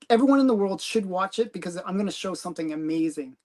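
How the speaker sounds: normal talking voice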